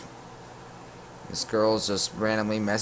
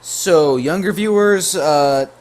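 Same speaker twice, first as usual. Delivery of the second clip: mock warning; 7 semitones higher, 7 dB louder than usual. Real, not acted. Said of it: "younger viewers, turn away now".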